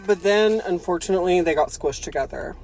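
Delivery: ordinary talk, nothing marked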